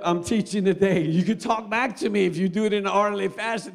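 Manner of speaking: normal talking voice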